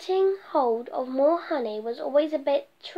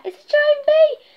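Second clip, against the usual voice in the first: much higher, louder frightened yell; terrified shriek; 14 semitones higher, 10 dB louder than usual. Acted. Bear fleeing the giant bee, terrified shriek. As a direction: scream it and run.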